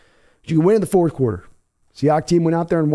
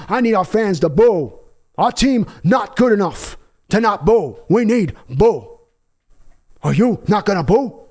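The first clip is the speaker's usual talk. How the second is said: rally the fans to boo like a fiery coach; Pacino speech; 2 semitones higher than usual. Acted. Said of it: "How about Pacino's Any Given Sunday speech take".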